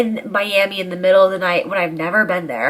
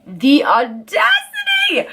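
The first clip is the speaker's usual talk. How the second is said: outraged shout; 10 semitones higher, slower than usual. Retold or imitated. Telling viewers about his nerve, slow indignant high cry. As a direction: shout the audacity up high in disbelief. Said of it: "And he had the audacity".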